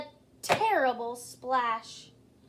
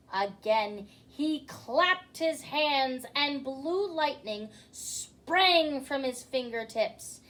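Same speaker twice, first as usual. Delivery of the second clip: ordinary delivery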